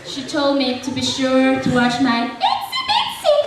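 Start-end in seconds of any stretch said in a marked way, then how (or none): 2.3-3.4 tiny, very high mimicked squeak; high-pitched babysitter. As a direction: squeak the pet name like the babysitter. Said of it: "talked in a high-pitched voice".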